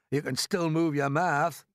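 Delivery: slow, lightly voiced earthworm; drawn-out, put-on grumbling retort; dry earthworm retort. A narrator's reading. Earthworm needles the stuck centipede, in a slow dry voice.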